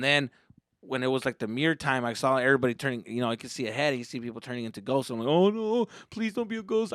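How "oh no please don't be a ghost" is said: high, small pleading voice; retold dread. Boy at the ghost mirror, high fearful pleading. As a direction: plead like a frightened kid.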